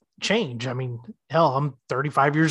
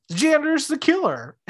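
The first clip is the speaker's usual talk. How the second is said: much higher eager blurt; mock blurt; 11 semitones higher than usual. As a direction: blurt it out proudly. [name] blurting the theory.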